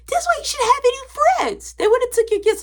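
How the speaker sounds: teasing little brother. Retold or imitated. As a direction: taunt like a bratty younger brother.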